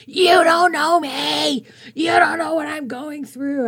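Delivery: high, slow, loud screaming at the narrator; furious shout. Retold or imitated. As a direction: yell it back like she's cornered. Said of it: "she yelled at me".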